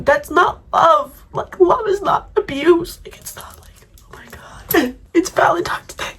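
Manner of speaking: tearful, breaking voice, half whispered; words pushed out through tears; crying on Valentine's Day; real crying. Real, not acted. It's Valentine's Day and I'm crying.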